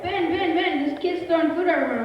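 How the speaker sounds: high, loud sing-song calling of a name; urgent mock call across the room. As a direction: kids yelling for the leader. Kids calling out for help.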